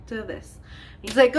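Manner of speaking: usual speaking voice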